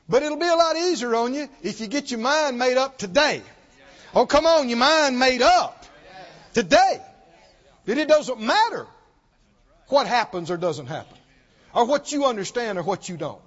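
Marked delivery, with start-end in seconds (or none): 0.1-10.9 loud, rising exhortation; full-force preaching. Acted. Preacher pressing his congregation to decide today, loud. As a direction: urge them on with all your voice.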